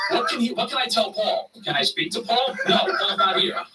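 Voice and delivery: flustered fake friend. Paul faking friend on phone.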